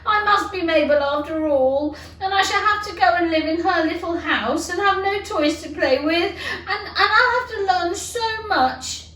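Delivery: Alice wailing through tears about being Mabel; crying. A narrator's reading. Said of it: "started to cry again".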